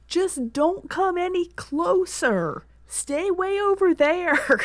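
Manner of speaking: high, slow, drawn-out calling; urgent warning call. Acted. Tammy Jo warns Herschel to stay back, calling high and slow. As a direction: stretch the words so they carry.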